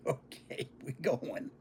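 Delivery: put-on small boy giving in to his brother; meek giving in. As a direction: mumble it like a beaten kid. Child gives in meekly.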